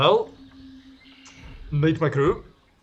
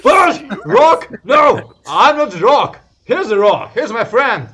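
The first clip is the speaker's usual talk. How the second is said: much higher, much louder voice; loud excited character; 12 semitones higher, 13 dB louder than usual. Acted. [name] loudly denying he is the Rock. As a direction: boom it out and point at your friend.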